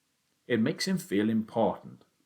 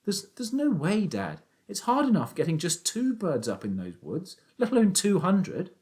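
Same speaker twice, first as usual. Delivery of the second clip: put-on young Danny, higher, doubtful; boy's voice; 11 semitones higher than usual. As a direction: object like a sceptical boy. Danny objects to his father.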